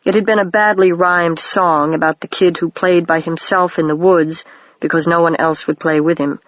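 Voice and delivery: ordinary delivery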